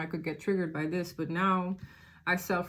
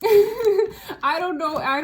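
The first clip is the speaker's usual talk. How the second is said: laughing; 10 semitones higher, 7 dB louder, much slower than usual. Real, not acted. Laughing at the viewer's situation.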